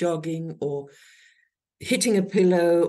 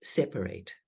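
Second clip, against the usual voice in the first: 1 low firm instruction; 10 semitones lower, 3 dB quieter than usual. Shown as a demonstration. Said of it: "generally we say".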